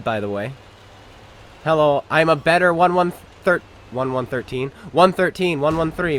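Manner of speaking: ordinary delivery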